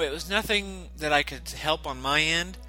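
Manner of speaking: ordinary delivery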